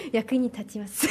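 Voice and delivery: high, giggly burst of laughter; laughing. Real, not acted. Student amused by her favourite onomatopoeia answer, laughing. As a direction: giggle helplessly around the words.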